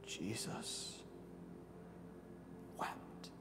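whispered, barely audible; soft, awed whisper; hushed. Acted. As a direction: let the words fall to a breath. Climax of the Lazarus story.

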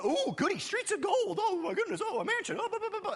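giggly sarcastic wonder; mocking glee. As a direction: parody a greedy kid at Christmas. Mocking shallow heaven-seekers.

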